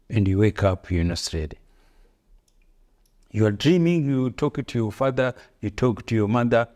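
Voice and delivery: ordinary delivery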